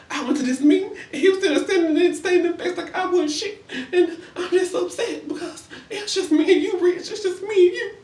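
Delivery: high, laughing mock plea to her man; mock Olivia. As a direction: play her as an overdone high-voiced whiner, cracking up. Imitating Olivia pleading with Rich.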